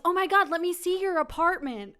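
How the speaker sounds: high, loud imitation of an eager guest; mock eagerness. Date pushing into her apartment, loud mock eagerness. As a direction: burst out in mock excitement.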